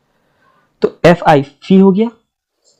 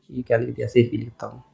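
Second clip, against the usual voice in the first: much quieter, lower voice, to oneself; muttered aside; 5 semitones lower, 13 dB quieter than usual. Real, not acted. Teacher remarking on own writing, muttered aside. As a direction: drop right down into an under-the-breath aside.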